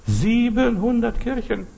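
high, drawn-out disbelief; astonished repeat. Real, not acted. Preacher stunned at churches lost in the Mexico earthquake, astonished.

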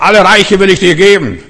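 high, loud proclamation as the tempter; grand devilish offer. Acted. Devil tempting Jesus with all kingdoms, grand loud offer.

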